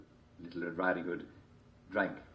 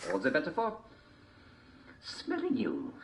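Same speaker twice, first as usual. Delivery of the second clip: high wolf voice; 10 semitones higher than usual. A narrator's reading.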